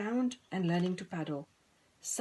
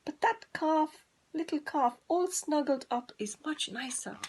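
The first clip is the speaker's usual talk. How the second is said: much higher, longing hen; 8 semitones higher than usual. Acted. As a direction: yearn for the calf in a high hen voice.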